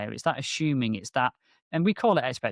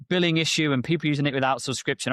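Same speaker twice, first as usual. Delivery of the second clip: normal talking voice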